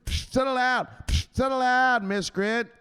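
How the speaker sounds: high-pitched bossy enforcer; Richetta. Acted. Enforcer cutting off a nervous trader, high loud command. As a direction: build from scolding to a loud order. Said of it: "Mister Richetta".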